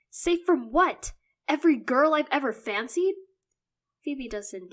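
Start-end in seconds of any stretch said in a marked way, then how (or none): 0.1-3.2 exasperated outburst. Acted. Son exasperated with his sister, loud. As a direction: throw the words up in disbelief. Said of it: "throwing my arms up in exasperation".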